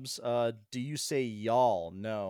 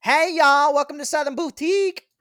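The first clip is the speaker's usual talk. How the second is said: mock Southern accent; 13 dB louder than usual. Mocking a Southern shop greeting to show a real Southern accent, in an exaggerated Southern accent. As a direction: belt it like an overeager Southern shopkeeper with a thick Southern accent. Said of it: "What's wrong with Southern accents?".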